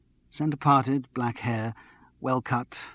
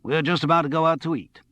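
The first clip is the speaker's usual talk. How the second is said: put-on louder, higher American accent; strong American accent; 6 semitones higher, 5 dB louder than usual. A narrator's reading. Escort explaining they are off to eat, strong American accent. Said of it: "in a strong American accent".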